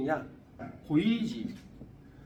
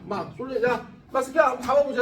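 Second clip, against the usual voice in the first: usual speaking voice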